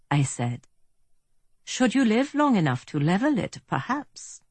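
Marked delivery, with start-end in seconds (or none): 1.7-4.4 put-on higher, lilting German accent; refined German accent, pitched up. A narrator's reading. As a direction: muse politely as a German villain. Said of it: "in a fine German accent".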